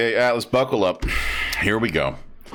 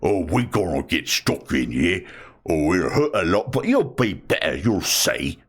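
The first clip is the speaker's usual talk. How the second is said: orc voice; 4 semitones lower than usual. Retold or imitated. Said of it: "just go".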